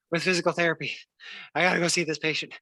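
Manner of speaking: winded after climbing stairs; puffing out of breath. Retold or imitated. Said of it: "Let me catch my breath first".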